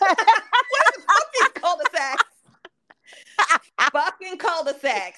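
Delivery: loud, incredulous scoff; mocking disbelief. Real, not acted. Friend scoffing at cul-de-sacs, loud and mocking. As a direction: sneer at the silly word.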